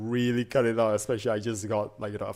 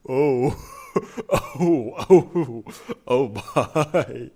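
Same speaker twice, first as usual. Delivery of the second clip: loud, higher exclamations broken by laughter; real helpless laughing; 4 semitones higher, 8 dB louder, much slower than usual. Real, not acted. Streamer shocked by what the search shows, laughing. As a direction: gasp it out between bursts of laughter.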